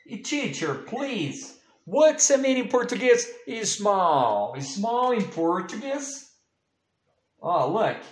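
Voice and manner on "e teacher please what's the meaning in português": put-on high, fast child's voice begging the teacher; pleading pupil. Teacher plays a child pleading for the meaning. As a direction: beg like a small pupil.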